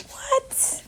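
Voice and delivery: a brief hammed-up West Indian accent; mock West Indian exclamation, the What breathy and high; fake West Indian accent. Cook praising the marinade, playful mock West Indian accent. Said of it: "I gotta work on them accents".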